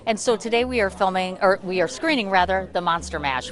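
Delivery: ordinary delivery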